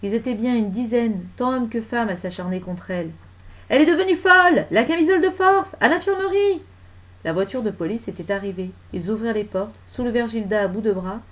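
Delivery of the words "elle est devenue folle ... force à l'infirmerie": high, loud hue and cry; scandalised high-pitched shouting; alarmed outcry. Acted. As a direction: call out in panic for restraint. Crowd cries out in alarm.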